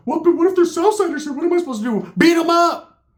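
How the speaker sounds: high, loud mock outburst; mock agitated protest. Ricky left posted up protests, high agitated mock yelling. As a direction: squeal the questions, then yell the last words.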